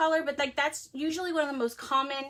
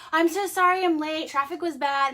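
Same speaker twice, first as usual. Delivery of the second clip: breezy fake excuse, raised and louder; mock apologetic self; 4 semitones higher, 7 dB louder than usual. Late patient to the receptionist, mock apologetic. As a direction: sheepishly blame the traffic. Retold apology to the doctor's office.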